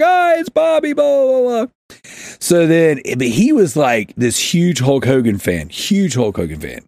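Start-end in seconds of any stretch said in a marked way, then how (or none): 0.0-1.7 high-pitched mock chorus of kids; excited kids. Schoolkids hailing Bobby at the bleachers, mock excited. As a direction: imitate kids cheering, pitched way up. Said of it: "the kids would be like".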